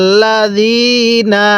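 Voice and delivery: ইন্নাল্লাযীনা intoned very slowly, the nasal held with ghunnah; teacher modelling ইন্নাল্লাযীনা in tajweed; slow Quranic recitation. Shown as a demonstration. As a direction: chant ইন্নাল্লাযীনা slowly, holding the double n. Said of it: "কিভাবে শিখব?".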